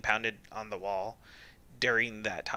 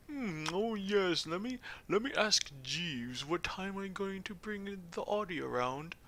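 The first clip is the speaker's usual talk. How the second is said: British highbrow; 7 semitones higher, 4 dB quieter than usual. Acted. Player mocking Snake's smug face, British highbrow voice. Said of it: "like, British highbrow".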